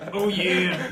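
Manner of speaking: big, loud mascot holler; Kool-Aid Man. Retold or imitated. Friend mimicking the character's voice, big Kool-Aid Man holler. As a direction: burst out in a booming cartoon voice. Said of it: "It's like the Kool-Aid man".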